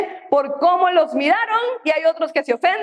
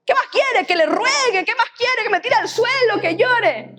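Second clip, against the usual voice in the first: mock indignant complainer; 6 semitones higher than usual. Mocks an unforgiving churchgoer.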